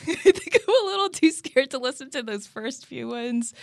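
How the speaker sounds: embarrassed giggle as she admits it; laughing. Real, not acted. Admits fear of early episodes.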